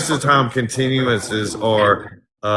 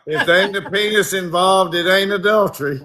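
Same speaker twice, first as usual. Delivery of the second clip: Virginia judge; 9 semitones higher than usual. Retold or imitated. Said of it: "He said".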